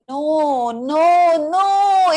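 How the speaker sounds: no, no, NO building up; rising inner protest. Retold or imitated. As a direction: refuse harder every time.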